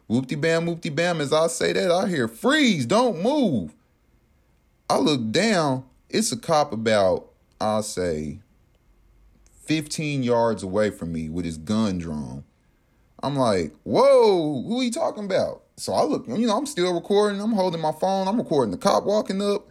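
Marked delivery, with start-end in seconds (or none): 2.3-3.7 high-pitched mock police order; imitating a cop's sharp order; retold police command. Cop shouting orders in retold story. 13.8-15.6 acted-out bewildered surprise; retold startled reaction.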